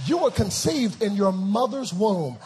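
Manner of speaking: loud, driving pulpit delivery; full-force preaching. Acted. Preacher recounts birth to congregation, full-force preaching. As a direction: fire rhetorical questions at the congregation.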